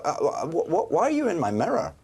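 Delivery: high-pitched gasp of recognition into stuttering; startled stammer. Acted. Host finds Dame Helen in his mirror, startled and stammering. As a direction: stammer in starstruck surprise.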